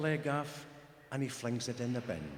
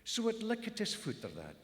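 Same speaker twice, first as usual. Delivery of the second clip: plain reading voice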